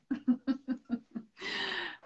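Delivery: quick high laugh; laugh, enjoying herself; bubbly amused laugh. Real, not acted. Laughs after her affirmation.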